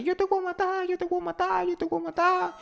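very high-pitched mock shriek; high shrieking threat. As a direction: shriek it up high like an angry wife. Woman shouting at unfaithful husband.

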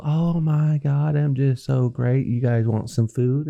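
slow, high, self-satisfied drawl; smug rich guy. Retold or imitated. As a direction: play a smug host showing off. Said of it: "that's the type of personality he's portraying".

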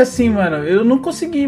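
ordinary delivery

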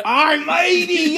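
very high, loud, drawn-out pirate yell; exaggerated pirate. Hamming up the pirate punchline, long high shout. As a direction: stretch it into a whooping pirate cry. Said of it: "What did the pirate say".

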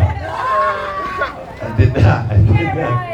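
laughing through a denial; real laughter breaking through the words. Real, not acted. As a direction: chuckle the words out, half breath. Denying inhaling, laughing through it.

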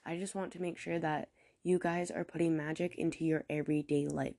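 ordinary talk, nothing marked

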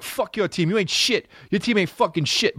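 high-pitched imitation of an angry guy; mock angry. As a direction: act out a heated street argument. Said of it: "But, like, for real angry".

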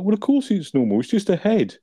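high, loud mock exasperation; high exasperated retort. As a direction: snap back high and impatient. Del's exasperated reply.

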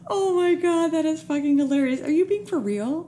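high, astonished after laughing; amused disbelief. Real, not acted. Host stunned by guest's story, amused disbelief. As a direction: react with real, laughing astonishment.

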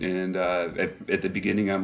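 ordinary talk, nothing marked

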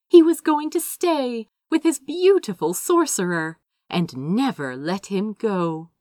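plain reading voice

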